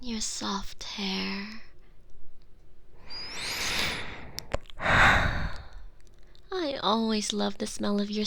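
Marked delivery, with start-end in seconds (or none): 0.0-1.6 slow breathy whisper over him; soft whisper. Acted. Girlfriend whispering to her captive partner, slow and soft. 1.7-6.5 long contented sigh. Acted. Long sigh while tending him.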